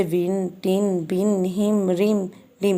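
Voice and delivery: "विन, टिन, बिन, हिम, रिम, डिम" each with a brief short i; short vowel sound. Shown as a demonstration. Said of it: "हम जल्दी से बोलते हैं".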